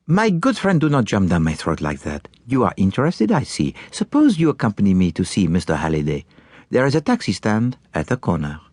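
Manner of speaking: put-on lively, accented detective voice; swooping, accented speech of a foreign detective; Belgian detective. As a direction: play an animated Belgian sleuth, lightly chiding then inviting. Voices Poirot in dialogue.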